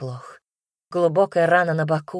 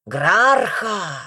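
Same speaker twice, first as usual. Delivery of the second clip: guttural beast roar, loud; deep distorted monster roar; 4 dB louder than usual. A narrator's reading. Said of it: "Рёв твари оглушал".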